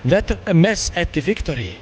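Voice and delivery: light put-on English accent; a hint of English accent on the quest text. Streamer voicing game characters in an English accent. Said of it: "that's why I was doing an English accent".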